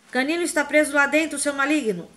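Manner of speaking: high, loud furious roar; roaring in rage. A narrator's reading. Hagrid roars at the Death Eater over his dog, furious. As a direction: bellow it in outrage. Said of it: "urrou Hagrid".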